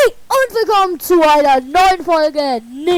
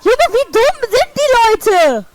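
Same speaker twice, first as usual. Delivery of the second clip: much higher, slow, louder disbelief; exasperated at foolish drivers; exasperated outburst; 7 semitones higher, 3 dB louder, slower than usual. Real, not acted. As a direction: cry out at their stupidity. Outraged at drivers in video.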